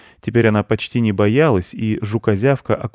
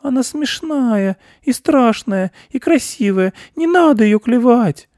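put-on very high, pleading little-girl wail; small girl working up to tears; about to bawl; 6 dB louder, slower than usual. A narrator's reading. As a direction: plead squeakily, building louder to the end. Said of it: "собираясь на всякий случай зареветь".

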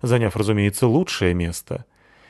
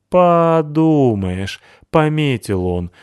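very slow, loud, higher scoff; scornful scoff; 7 semitones higher, 5 dB louder, much slower than usual. A narrator's reading. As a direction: drag out the mockery. Cat scoffs at the hedgehog.

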